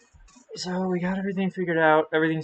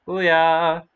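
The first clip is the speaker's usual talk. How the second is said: excited; 2 semitones higher, 6 dB louder than usual. Real, not acted. Cheer greeting.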